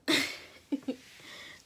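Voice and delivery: breathy laugh after the punchline; real burst of laughter. Real, not acted. Teenager laughing at her own refusal of the pastor. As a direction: laugh at your own comeback.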